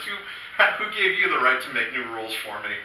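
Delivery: ordinary talk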